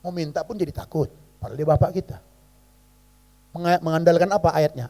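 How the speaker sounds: usual speaking voice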